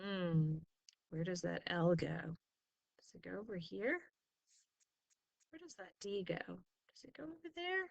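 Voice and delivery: soft, hushed thinking aloud; quiet musing. Real, not acted. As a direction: wonder quietly to yourself where it goes. Teacher thinking aloud over tiles.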